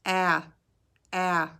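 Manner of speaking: "A, a" with the chin dropped wide; repeating the short a slowly for the class; short a, exaggerated. Shown as a demonstration. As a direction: show the jaw drop on "a". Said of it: "Watch again".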